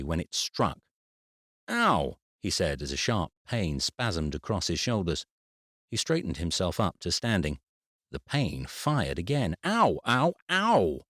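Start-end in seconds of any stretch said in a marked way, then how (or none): 1.7-2.2 a sharp high yelp of pain; sudden pain yelp. Acted. Sharp pain spasmed across shoulders. 9.6-11.1 loud, high yelps of pain; repeated pain yelps. Acted. Pain fired again.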